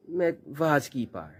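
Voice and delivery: ordinary talk, nothing marked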